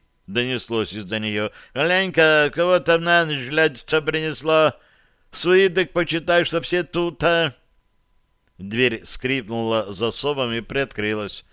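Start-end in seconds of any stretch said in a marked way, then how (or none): 1.7-7.5 higher voice from behind a door; raised character voice; calling out. Acted. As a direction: pitch it up like someone calling to housemates. Grumbling call from behind door.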